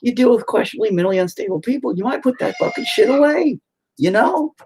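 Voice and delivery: patient scolding therapist, increasingly exasperated; exasperated explaining. Retold or imitated. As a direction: lecture the therapist, building to exasperation. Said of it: "And you're like".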